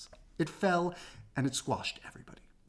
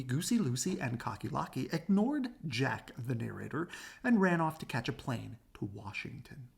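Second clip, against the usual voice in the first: ordinary delivery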